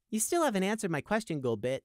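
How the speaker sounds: high-pitched challenge, dropping on the name; pointed, insistent comeback; shot-back retort. A narrator's reading. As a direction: fire it back at the guard. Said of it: "Prey shot back".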